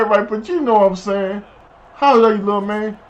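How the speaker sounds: ordinary delivery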